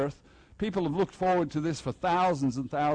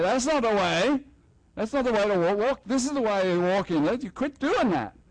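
a bit higher and louder, half-playful reprimand; mock scolding; 5 semitones higher, 3 dB louder than usual. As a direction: correct them like a stern watchman, lightly. Invisible police scolding troublemakers.

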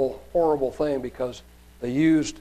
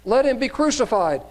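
higher-pitched, mildly raised cry; 9 semitones higher, 3 dB louder than usual. A narrator's reading. Crowd demanding crucifixion before Pilate, mildly crying out. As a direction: read it as a restrained crowd outcry. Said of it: "And they cried out the more".

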